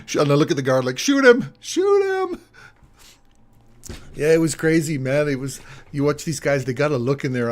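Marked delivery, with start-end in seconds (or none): 1.0-2.4 very high, squeaky mock panic; hammed-up terrified squeal, louder; mock panicked plea. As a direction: beg the guard in a comic falsetto. Retold plea to the guard.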